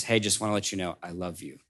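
soft, fading I love you; tender. Acted. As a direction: let it drop gently to a near hush. Woodmaker's parting words to Max.

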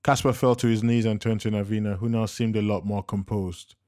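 usual speaking voice

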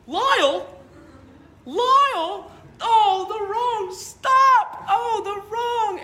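put-on high, loud, frantic Chicago old man shouting; panicked Chicago old man crying out his mistake; thick Chicago accent. Old man in a thick Chicago accent panicking about the wrong stop. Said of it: "he's got this real thick, uh, Chicago accent".

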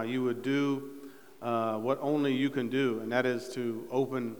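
low, soft, reverent praying voice; hushed, humble petition to God; prayer. Real, not acted. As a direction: lead a quiet prayer, pitched low and subdued. Leads the congregation in prayer.

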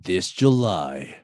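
low, very slow trailer read; dramatic trailer narrator; movie trailer voice. Acted. Reading tagline dramatically.

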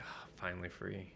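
whispered, imagined sigh of relief; breathy relief. Acted. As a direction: let it out softly, almost voiceless.

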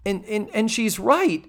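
ordinary delivery

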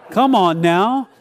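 ordinary talk, nothing marked

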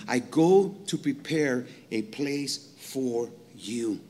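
slow, soft promise; Jesus speaking tenderly. Retold or imitated. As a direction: say it slowly like a gentle promise. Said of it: "he's looking at them at the table".

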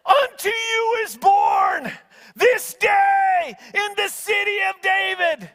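high, slow, loud proclamation; booming heavenly announcement, drawn out; proclaiming angel. Retold or imitated.

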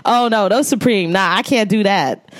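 mock New York lady, turning the shoes down. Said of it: "that was her accent".

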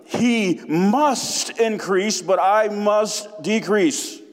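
slow, each word weighted; emphatic reading. Shown as a demonstration. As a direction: lean hard on "increase" and "decrease", drawn out slowly. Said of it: "Notice the emphaticism".

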